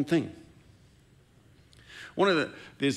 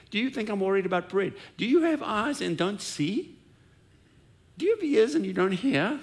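exasperated Jesus; 6 semitones higher than usual. Retold or imitated. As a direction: pile on the questions, higher and exasperated. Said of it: "and he goes like".

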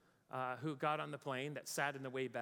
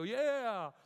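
louder, much higher burst of agreement; loud agreeing yeah; 8 semitones higher, 8 dB louder than usual. Retold or imitated. Preacher joining an angry passenger's complaint, loud mock yeah. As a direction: shout yeah along with him. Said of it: "I'm about to go".

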